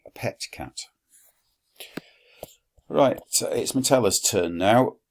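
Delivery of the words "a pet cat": soft whispered aside; whispered thought. Acted. Quintus thinking it privately, whispered. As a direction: breathe it out as a secret.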